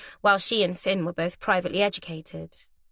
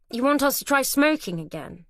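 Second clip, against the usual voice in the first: disappointed; 6 semitones higher than usual. A narrator's reading. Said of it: "Finn said with disappointment".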